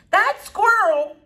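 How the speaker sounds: loud, high, drawn-out outrage; indignant high outcry; outraged mother. Acted. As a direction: exclaim it slowly in alarm and disgust.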